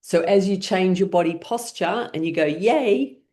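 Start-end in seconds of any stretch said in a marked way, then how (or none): 2.5-3.2 higher, bright cheer of yay; a quick cheer to signal excitement; excited yay. Shown as a demonstration. Teacher names the cheer as a signal of excitement, excited. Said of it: "giving your body a signal that there's excitement".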